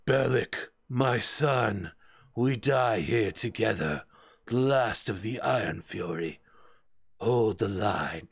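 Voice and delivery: measured, solemn heroic last words; dying warrior father. Acted. Dwarf father to his son in a last stand, solemn dying voice. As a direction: play a fallen hero's final speech. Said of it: "the guy goes".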